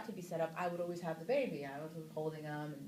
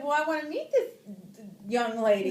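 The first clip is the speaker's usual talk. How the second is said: higher and louder, with a laugh at the end; protective mom; 5 semitones higher, 14 dB louder than usual. Retold or imitated. Screen mom demanding to meet her son's fiancée, loud, high and laughing. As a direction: play the overprotective mother sizing up the fiancée. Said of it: "I was like".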